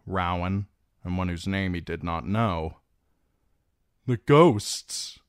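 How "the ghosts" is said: high, loud startled outburst; blurted. A narrator's reading. Theon answers the washerwomen, startled blurt. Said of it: "he blurted".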